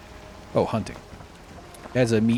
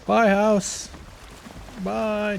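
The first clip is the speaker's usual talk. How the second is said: playful farewell voice; 12 semitones higher, much slower than usual. Pretend. Waving goodbye to the house, playful high voice. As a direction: sing out a silly high farewell.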